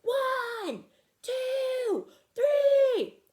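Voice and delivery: very high loud count. Acted. Fred counts the big push, very high loud count. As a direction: push it out, rising to a shout.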